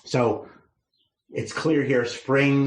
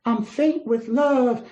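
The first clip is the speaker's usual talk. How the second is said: high, drawn-out swooning Southern belle; faint away like a lovesick Southern belle; 13 semitones higher, slower than usual. Acted. Lovesick maiden declaring she is faint with love, as a Southern belle. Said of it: "imagine her as like a Southern belle".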